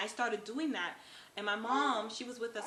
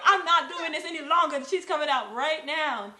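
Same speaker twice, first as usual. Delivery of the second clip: determined outburst; 5 semitones higher, 10 dB louder than usual. Retold or imitated. Determined thought while pushing.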